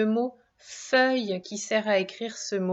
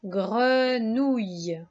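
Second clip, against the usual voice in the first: slow dictation; much slower than usual. Shown as a demonstration. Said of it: "comment est-ce qu'on pourrait écrire".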